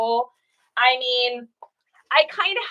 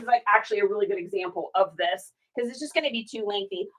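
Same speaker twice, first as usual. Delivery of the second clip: ordinary delivery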